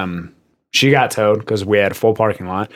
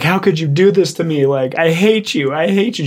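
higher-pitched mock wailing accusation; retold bawling; 6 semitones higher than usual. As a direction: imitate a distraught, bawling customer, lightly hammed up. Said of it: "she just started bawling".

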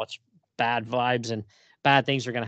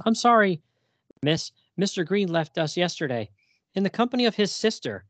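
higher, polite receptionist voice; lightly voiced clinic receptionist; phone receptionist; 7 semitones higher than usual. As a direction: play the clinic clerk on the phone.

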